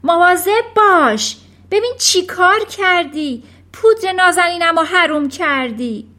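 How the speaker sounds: high, very loud screech; upset mother's shrill outburst; shrieked scolding. A narrator's reading. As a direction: scream a warning, then scold. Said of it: "مادر جیغ کشید".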